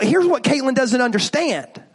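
normal talking voice

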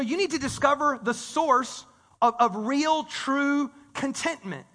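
slowed right down, drawing out "real, true contentment"; slow, deliberate. Shown as a demonstration. Preacher repeating his point for the congregation, slowly. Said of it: "Let me say that again slowly".

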